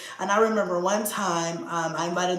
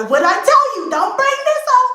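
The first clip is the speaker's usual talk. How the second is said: high, loud exasperated telling-off; retold scolding; 8 dB louder than usual. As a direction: scold him at raised pitch, acting it out.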